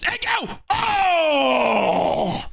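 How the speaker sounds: high shouting play-by-play; excited yell. Real, not acted. Fan calling an attack on his team, high shouting. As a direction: shout each name as the attack builds. Attacking chance live call.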